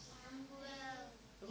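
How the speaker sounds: very soft, distant call. A narrator's reading. God calling the waiting boy, very softly. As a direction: breathe the name like a faraway voice. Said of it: "dan hoort hij".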